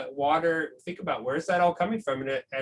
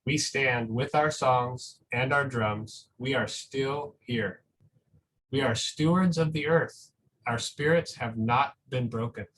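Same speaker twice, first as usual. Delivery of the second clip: put-on reading to children; 3 semitones lower than usual. Reads a picture book aloud.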